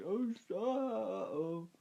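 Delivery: high-pitched startled yelps; mock alarm. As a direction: act out a cartoonish fright, pitched high. Acts out shock at the fridge.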